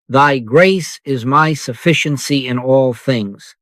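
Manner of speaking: ordinary talk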